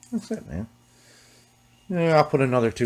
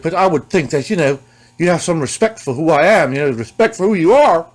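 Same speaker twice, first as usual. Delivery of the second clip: higher, louder put-on voice claiming respect; 7 semitones higher, 6 dB louder than usual. [name] insisting on respect from the seller, in a put-on high voice. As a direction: puff up like a star demanding respect. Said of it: "He's like".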